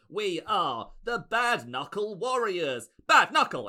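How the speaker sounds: high, drawn-out grand announcement rising to a shout; hammed-up warrior battle cry; proud gang leader. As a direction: declare the gang name with bravado. Gang leader announces the gang's name.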